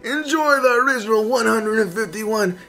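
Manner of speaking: high, whiny mock complaint; nagging fan whine; whiny viewer voice. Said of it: "I know what you guys are all thinking".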